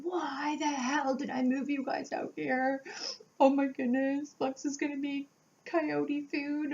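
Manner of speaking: high, teary retelling of her own frantic cursing; retold tearful panic. Mother lost in the woods curses herself to her daughter, high and teary. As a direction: replay the panic, half crying and cursing yourself. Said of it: "I'm cursing myself".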